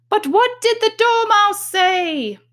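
very high, slow questioning voice; high juror voice. A narrator's reading. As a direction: ask it in a high squeaky tone. Juror asks.

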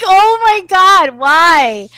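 high, loud, drawn-out mock despair; exasperated cry. Gamer to sister who jumped too soon, exasperated cry. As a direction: wail it at a hopeless teammate.

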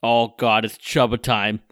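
loud hammed-up Boston sports radio voice; mock a Boston radio caller, big and loud; exaggerated Boston accent; ridiculous Boston accent. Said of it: "all those ridiculous Boston accents".

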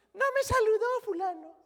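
put-on very high, tattling little-child voice; whiny childish believer. Pastor mocks immature churchgoer tattling, very high childish whine. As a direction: squeak it like a small child telling on someone. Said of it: "Niños".

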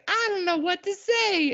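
small, very high and slow; timid little kid voice. Retold or imitated. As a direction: squeak it out like a shy little boy. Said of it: "He's like".

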